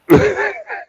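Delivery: short, loud high laugh. Real, not acted. Amused at the idea of a crazy trucker, laughing.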